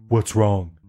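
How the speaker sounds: low, deadpan imitation of a text reply; put-on low voice. Woken woman texting back, in a low put-on voice. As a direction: drop low to voice her text. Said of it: "In that voice, too?".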